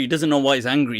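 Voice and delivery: ordinary delivery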